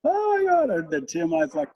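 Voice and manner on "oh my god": high shrieking oh my God, pitched way up; panicked son. Retold or imitated. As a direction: squeal it in alarm like a scared boy. Son panicking over mouse.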